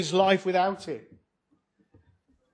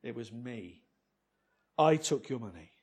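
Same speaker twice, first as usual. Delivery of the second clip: quiet confession; 7 semitones lower, 9 dB quieter than usual. Retold or imitated. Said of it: "he confesses".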